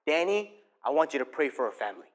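low, soft inner voice; Holy Spirit's voice. Retold or imitated. The Holy Spirit prompting Danny in his heart, low and quiet. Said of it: "I feel the Holy Spirit's voice in my heart".

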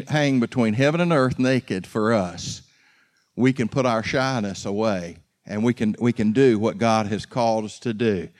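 ordinary talk, nothing marked

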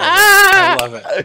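high squealing laugh through words. Real, not acted. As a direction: squeal it out, delighted. Laughing squeal at own character.

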